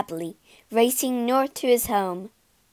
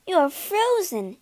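exclaiming; 8 semitones higher, slower than usual. Acted. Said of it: "she exclaimed to Gerda".

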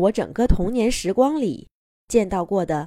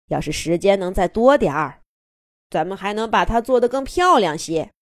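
proud grandpa voice; 3 dB louder than usual. A narrator's reading. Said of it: "多米爷爷的语气有点得意，又有点小遗憾".

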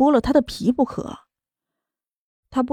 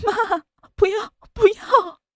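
high, slow tearful pleading; crying refusal; 10 semitones higher, slower than usual. A narrator's reading. Daughter shaking her head at her mother, crying. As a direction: sob the words out slowly, much higher. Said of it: "江晚竹哭着摇头".

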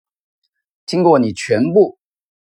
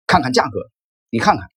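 ordinary delivery, nothing marked

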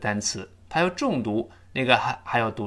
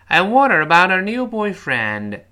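loud, higher, linked in one breath; faster read-along; 5 semitones higher, 7 dB louder than usual. Shown as a demonstration. Teacher asking students to repeat faster, loud and linked. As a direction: run the sentence together briskly. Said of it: "快一点".

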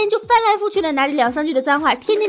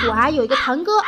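ordinary talk

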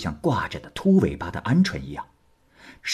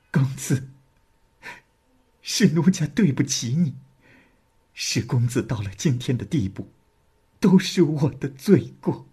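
higher, tear-choked apology; tearful confession; 5 semitones higher than usual. A narrator's reading. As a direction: weep out her guilt to him. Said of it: "失声痛哭地说". (哭着认错)

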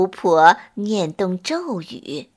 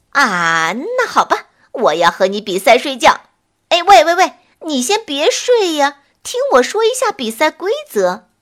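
eager calling out; 4 semitones higher, 4 dB louder than usual. Acted. Witch calls out to the sloth.

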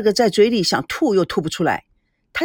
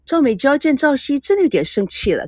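usual speaking voice

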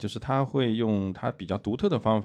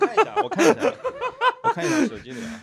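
high, laughing fluster; flustered giggly search; faster than usual. Real, not acted. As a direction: laugh while fumbling for the answer. Embarrassed while searching phone.

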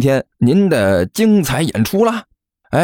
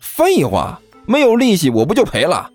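higher-pitched scornful retort; sneering; 9 semitones higher than usual. Acted. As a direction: dismiss it with a cold little sneer. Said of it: "甘球冷笑着说道". (冷笑着反驳)

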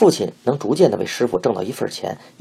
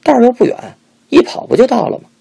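high, loud defiant comeback; stubborn retort; 12 semitones higher, 7 dB louder than usual. A narrator's reading. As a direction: refuse to back down. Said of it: "坚持自己的道理".

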